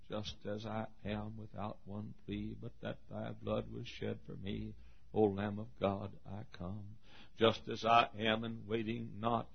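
hymn recitation. Acted. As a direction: recite the hymn quietly and reverently.

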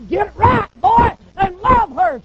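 hammed-up divine rebuke; stern scolding Lord. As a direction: scold him like a fed-up father.

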